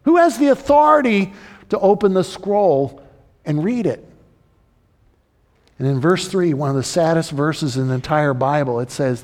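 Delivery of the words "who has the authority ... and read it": high, insistent preacher's questions; urgent pulpit voice, pitched up; impassioned preaching. Real, not acted. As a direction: press the congregation with ringing questions. Preacher at full force.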